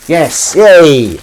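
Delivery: loud, high cheer of victory; excited cheer. Real, not acted. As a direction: whoop at getting it right. Guessed quote right.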